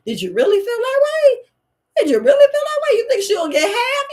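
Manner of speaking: high, loud, breaking hammed-up wail; mock anguished cry. A spouse who feels betrayed, mock crying shout. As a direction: act out crying, betrayed disbelief. Mocking a partner in shock.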